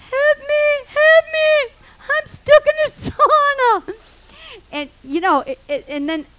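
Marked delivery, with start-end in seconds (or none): 0.1-3.9 high, loud wail for help; desperate cries. Retold or imitated. Trapped woman calling through a crack under the door, desperate. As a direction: call out desperately, pitch way up. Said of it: "and I'm going".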